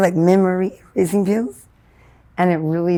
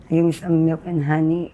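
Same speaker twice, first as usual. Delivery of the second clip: ordinary talk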